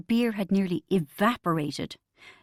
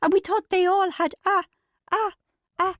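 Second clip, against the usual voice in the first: much higher, louder, words breaking off; laughing too hard to speak; 11 semitones higher, 5 dB louder than usual. Acted. Bridget trying to explain while helpless with laughter. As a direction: choke on the words between laughs. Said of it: "She couldn't really speak, she was laughing so much".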